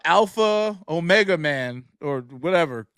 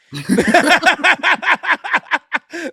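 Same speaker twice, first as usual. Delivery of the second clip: real hearty laugh, pitched up; cracking up out loud; loud burst of laughter; 11 semitones higher, 6 dB louder than usual. Real, not acted. Reacting to his friend's joke, with loud real laughter.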